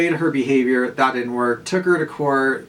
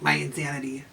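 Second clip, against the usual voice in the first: Gollum voice; 6 dB quieter than usual. Retold or imitated. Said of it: "literally looks like Gollum".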